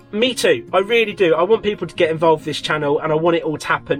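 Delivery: usual speaking voice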